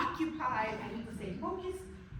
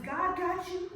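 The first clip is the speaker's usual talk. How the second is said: assured reassurance; 5 semitones higher, 6 dB louder, slower than usual. Acted. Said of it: "I'm always telling others".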